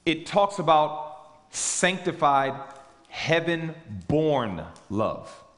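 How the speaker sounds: sanctified, heaven-born love said very slowly, each word weighed; preacher lingers on the phrase; stress on sanctified. Shown as a demonstration. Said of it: "why I'm emphasizing".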